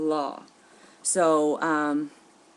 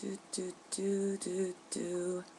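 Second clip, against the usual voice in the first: much softer "doot" ringing tones; soft dial tone; 13 dB quieter, slower than usual. Retold or imitated. Phone ringing in the congressman's ear, soft mock ringing. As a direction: murmur the ringing line under your breath. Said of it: "sits there awkwardly with the phone".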